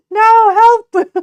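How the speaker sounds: high, loud mock-distressed yelp; a laughing, high-pitched plea to chat; playful cry for help. Real, not acted. Chat teasing, she cries out.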